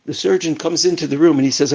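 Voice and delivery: usual speaking voice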